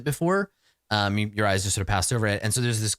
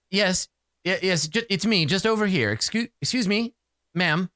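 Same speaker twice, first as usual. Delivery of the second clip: higher, stammering polite call; prim voice; 6 semitones higher than usual. Acted. Hidden voice calling Bryn again, prim and apologetic. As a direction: fuss for attention, prim and halting. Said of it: "sort of prim, uh, voice".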